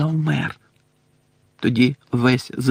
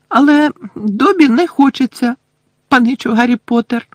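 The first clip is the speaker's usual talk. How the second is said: high squeaky elf voice, back to normal; house-elf Dobby, normal voice; 11 semitones higher, 6 dB louder than usual. Acted. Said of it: "знову нормально заговорив ельф". (писклявий голос ельфа Добі)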